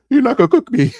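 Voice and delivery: high, tearful mock protest; voice cracking. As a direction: act out a voice breaking on the edge of tears. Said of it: "My voice started cracking".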